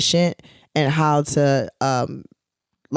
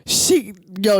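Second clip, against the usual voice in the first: normal talking voice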